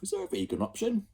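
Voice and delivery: high quick prim question; picky kid asking; fussy child. Acted.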